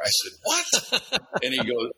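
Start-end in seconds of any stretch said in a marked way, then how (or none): 0.4-1.2 high-pitched incredulous retort; retold reaction of baffled surprise; startled disbelief. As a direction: blurt it out in mock disbelief. Reacting to parole officer claim.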